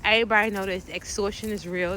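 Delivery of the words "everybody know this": ordinary talk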